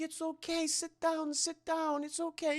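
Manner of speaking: high, soft creepy cooing; imitated soothing. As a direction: coo it softly and high, playing Esther. Imitates Esther soothing father.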